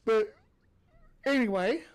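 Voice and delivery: word stretched by a yawn. Real, not acted. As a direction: say it mid-yawn. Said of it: "I'm yawning".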